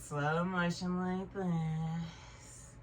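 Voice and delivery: drawn-out slow-motion demo. Shown as a demonstration. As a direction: stretch each word out as if in slow motion. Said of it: "I'm going really slow".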